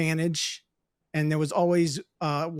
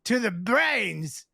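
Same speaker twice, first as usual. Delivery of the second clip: put-on Scottish drunk. Drunk Scot voiced in story.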